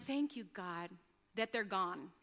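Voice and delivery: quiet grateful aside to God; hushed relieved prayer. Retold or imitated. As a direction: mutter the prayer under your breath, relieved. Relieved secret prayer after guests leave.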